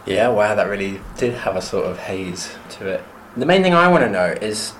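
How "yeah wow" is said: ordinary talk, nothing marked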